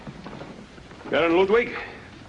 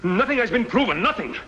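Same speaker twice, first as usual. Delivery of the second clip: rising heated insistence, higher; angry protest; 8 semitones higher than usual. Acted. Colonel defending his president against Barrero, angry. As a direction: hammer the denial home. Heated quarrel over the letter.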